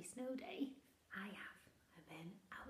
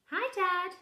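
put-on high, loud bright child voice; child's greeting; 10 semitones higher, 19 dB louder than usual. Bernard calling out hello to his father. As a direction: call out like an eager little boy.

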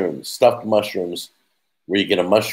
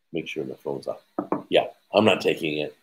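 ordinary talk, nothing marked

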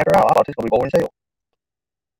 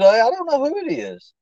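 high-pitched puzzled guess; high excited guess; 12 semitones higher, slower than usual. Real, not acted. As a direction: voice jumps way up, slow.

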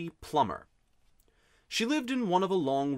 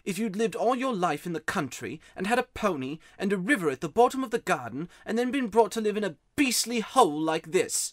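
put-on high-pitched miserable boy's outburst; wound-up boy; 7 semitones higher, 3 dB louder than usual. A narrator's reading. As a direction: pour out the complaint as an upset boy. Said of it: "the boy was too wound up".

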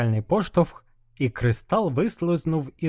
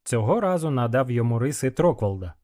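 ordinary delivery

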